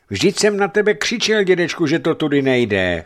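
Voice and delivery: put-on high, loud child's reproach; upset boy's outcry. A narrator's reading. Boy reproaches grandfather after the crash, crying out loudly. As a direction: protest loudly, starting high and coming down.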